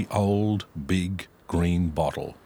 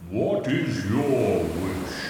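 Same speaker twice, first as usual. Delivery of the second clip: slow, booming genie voice; grand slow magical giant; much slower than usual. A narrator's reading. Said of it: "the genie asked Ron".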